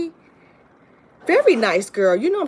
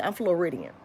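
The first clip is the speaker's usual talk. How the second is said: ordinary talk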